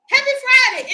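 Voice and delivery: normal talking voice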